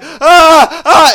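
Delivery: high loud fake screaming; playful hammed-up kid scream; mock scream back.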